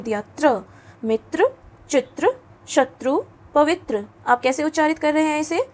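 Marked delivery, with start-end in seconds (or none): 0.4-4.1 त्र with the tongue held on त, full र; model pronunciation. Shown as a demonstration. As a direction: demonstrate मित्र and चित्र with a clear र. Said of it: "त के लिए जीभ को रोका". (त्र का स्पष्ट उच्चारण, पूरा र बोलते हुए)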